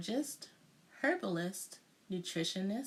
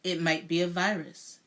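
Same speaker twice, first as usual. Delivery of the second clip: with authority; 3 semitones lower, 7 dB louder than usual. A narrator's reading. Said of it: "he announced with authority".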